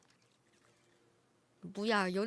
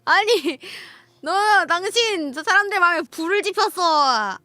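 loud, higher exasperated yell; loud outburst; 6 semitones higher, 9 dB louder than usual. Real, not acted. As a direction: yell at them in mock outrage. Outburst at donor.

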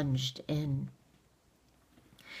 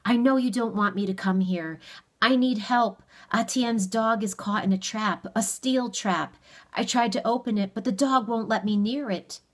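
higher, louder plea for help; pressing, raised-pitch explanation; urgent appeal; 6 semitones higher, 4 dB louder than usual. A narrator's reading. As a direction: plead your case urgently.

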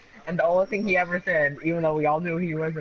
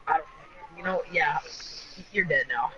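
ordinary talk, nothing marked

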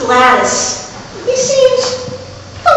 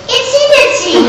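high and cute; 13 semitones higher than usual. Acted. Punchline of the naming story.

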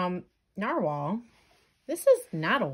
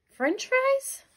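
incredulous; 4 dB louder than usual. Acted. Jelly listing foods incredulously.

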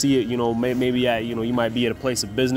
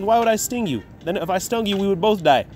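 higher, coaxing reassurance; a lightly voiced smooth scorpion; scorpion persuading; 7 semitones higher than usual. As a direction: sweet-talk him like a sly scorpion. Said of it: "He says".

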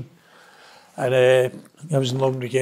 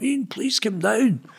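retold pleading shout; 8 semitones higher, slower than usual. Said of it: "the governor shouting up".

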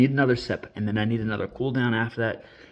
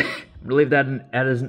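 ordinary talk

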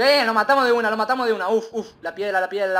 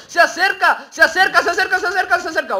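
high, loud, laughing alarm; nervous laughing shout; 11 semitones higher, 8 dB louder than usual. Real, not acted. Gamer as an enemy closes in, panicked laughing.

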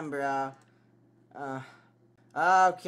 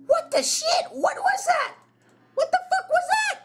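scared shriek; 4 dB louder than usual. Real, not acted. Jump scare, shrieking in fright.

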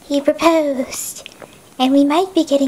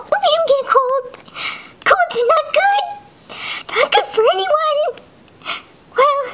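put-on chirpy little girl, much higher; high-voiced kid; 7 semitones higher, faster than usual. [name] frets to Mary Grace in a high kid voice. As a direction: bubbly kid fretting over a friend.

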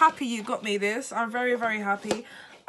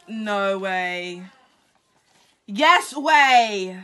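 normal talking voice

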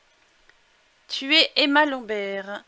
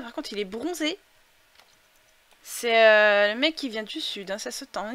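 ordinary delivery

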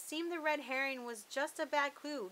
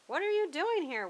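a hint of a sneering villain, higher and louder; lightly voiced sneering waffle; mild sneer; 8 semitones higher, 8 dB louder than usual. A narrator's reading. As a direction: sneer at the intruders.

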